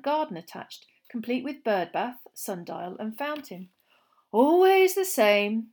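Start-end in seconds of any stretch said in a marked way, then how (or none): 4.3-5.7 amused smile. A narrator's reading. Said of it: "said Mister Weasley, smiling".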